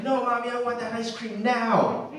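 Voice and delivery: a hint of a stomping angry kid; mock angry child. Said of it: "he gets angry and he's like".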